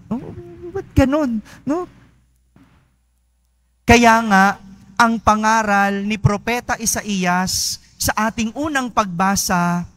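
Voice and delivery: usual speaking voice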